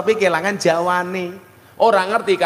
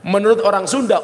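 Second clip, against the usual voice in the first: usual speaking voice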